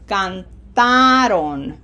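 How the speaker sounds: slow canTAron, TA held and loud; stress on middle syllable. Shown as a demonstration. Teacher returns to it for learners, Cantaron stressed on TA. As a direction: stretch the TA in Cantaron, then fall. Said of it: "this is going to sound".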